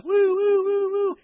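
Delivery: high, loud woo-woo of a police siren; siren imitation. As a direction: wail like a police car pulling him over.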